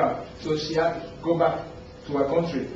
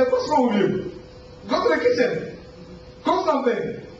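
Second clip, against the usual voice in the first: higher, mock-angry mother scolding; 8 semitones higher than usual. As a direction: scold the grown kid like an exasperated mom. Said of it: "She will scream and say".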